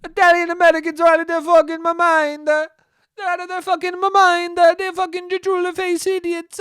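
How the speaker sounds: high, loud Italian caricature rant; hammed-up Italian accent, heaping on scorn; mock Italian accent. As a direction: rant like an indignant cartoon Italian. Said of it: "they would be like".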